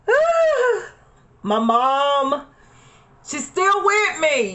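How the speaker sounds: real tears rising, pitch cracking up; emotional. Real, not acted. Telling viewers about her late mom, voice breaking. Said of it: "excuse me if I get a little bit emotional".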